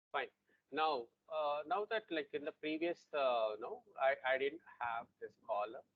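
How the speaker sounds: faint, far from the mic; barely audible. Real, not acted. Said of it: "your voice is very faint".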